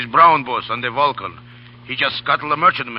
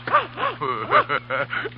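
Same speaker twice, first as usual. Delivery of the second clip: a shrill burst of cackling laughter; high cackling laugh. Acted. Crewman laughing at a barking fish, high cackle. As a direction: cackle at the strange sight.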